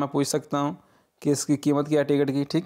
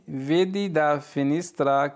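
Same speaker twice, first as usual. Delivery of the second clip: very slow, each syllable clear; slow model sentence; much slower than usual. Shown as a demonstration. Teacher reading the Italian phrase for students, very slow. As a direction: read it out slowly for learners to repeat. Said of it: "खिड़की से देखो".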